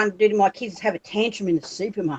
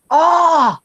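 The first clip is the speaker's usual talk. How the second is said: excited call; 5 semitones higher, 4 dB louder, much slower than usual. Retold or imitated. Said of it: "I'm like".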